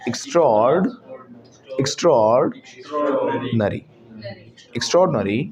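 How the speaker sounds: "extraordinary" as ik-STRORD-nuh-ree; corrected pronunciation. Shown as a demonstration. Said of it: "इसको बोलेंगे".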